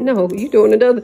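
normal talking voice

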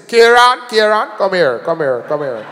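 imitated Jamaican dad's booming call. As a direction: call the boy over as a Jamaican father. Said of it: "he was Jamaican, Jamaican".

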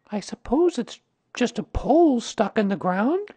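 put-on high, questioning boy's voice; Christopher Robin. A narrator's reading. Christopher Robin asks Rabbit.

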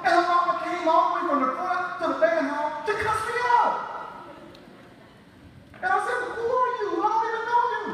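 emotional, on the verge of crying; choked up. Real, not acted. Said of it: "I'm gonna take a minute".

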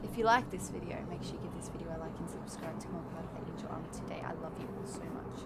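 low, very fast, barely voiced; rushed outro. Real, not acted. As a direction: rattle off the outro.

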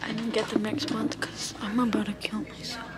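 frustrated whisper to self. Real, not acted. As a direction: mutter it under your breath, barely voiced. Muttering to self in store.

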